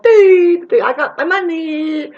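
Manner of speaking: very high, loud, dopey mocking sing-song; mock idiot gloating over money; dumb mock voice. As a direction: play them as brainless and pleased with the cash. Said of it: "walking around like".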